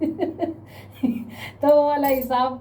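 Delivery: slow words broken by a real laugh; amused laugh while telling the scandal. Real, not acted. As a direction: chuckle through it, drawn out. Laughing at the absurd affair.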